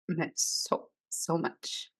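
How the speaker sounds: barely getting the words out, moved; choked-up whisper. Real, not acted. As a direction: voice dropping to a tearful whisper. Mother moved by son's praise.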